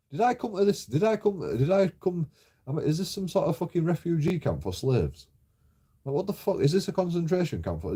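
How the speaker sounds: high-pitched outraged ranting; indignant rant. Real, not acted. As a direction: vent your outrage, pitch climbing. Indignant rant about landlord's demands.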